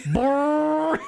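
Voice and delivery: loud high lip-trill fart; fart imitation. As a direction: buzz your lips like a fart.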